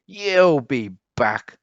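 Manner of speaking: hammed-up cross cat; storming cat. A narrator's reading. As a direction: let it land heavily, storybook anger. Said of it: "stormed the cat".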